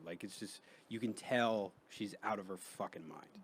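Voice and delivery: ordinary talk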